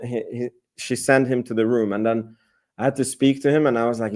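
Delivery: normal talking voice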